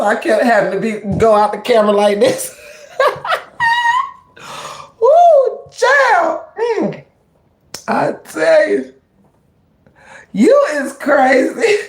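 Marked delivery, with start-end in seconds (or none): 3.0-4.1 high squealing laugh. Real, not acted. Breaking up after the prank. 5.0-11.8 laughing high. Real, not acted. Laughing over own prank.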